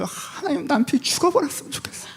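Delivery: spouse's joking prayer to God; mock prayer. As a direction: voice the spouse's secret wish. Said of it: "기도하세요".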